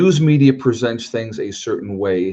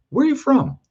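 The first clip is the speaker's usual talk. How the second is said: high-pitched mimicked question; quoting someone in a much higher voice; imitated stranger. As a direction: put on a high voice for a curious stranger.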